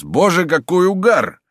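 high, slow startled exclamation; shocked cry outside the door. A narrator's reading. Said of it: "послышался возглас".

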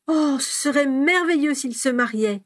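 high-pitched rapture; delighted. A narrator's reading. Lily wishing Teddy would marry, delighted. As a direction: gush with dreamy delight. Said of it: "murmura Lily avec ravissement".

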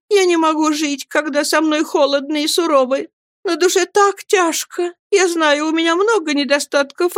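high, beseeching woman's voice; meek, distressed entreaty; anxious pleading mother. Acted. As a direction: beg to make peace, pitch held high throughout. Mother begs husband and his sister.

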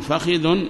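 فخذ as "fakhidhun"; short a then short i in فخذ; first dialect form. Shown as a demonstration. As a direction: say فخذ as fa-khi-dhun. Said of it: "ثلاث لغات".

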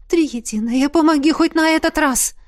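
high-pitched imploring prayer; pleading, pitch climbing to the end; desperate plea. A narrator's reading. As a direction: beg a goddess for help. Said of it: "взмолилась королева".

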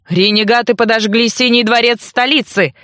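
ordinary delivery, nothing marked

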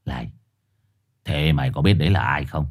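low, quick grave question; gruff dog voice. Acted. Four-eyed dog quizzing Trồn, low and gruff. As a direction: lean in with a growl.

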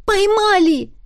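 высоко и громко, ликующе; выкрикни победно; радостный возглас. Acted. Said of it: "едва ли не хором воскликнули мы". (ликующий высокий выкрик)

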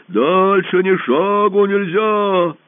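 very slow, loud booming boast; boasting monster. A narrator's reading. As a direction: boom out your might. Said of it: "Голос чудовища".

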